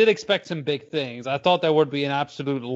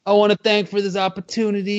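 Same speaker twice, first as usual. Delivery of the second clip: mock speech; 6 semitones higher than usual. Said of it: "give us a reenactment".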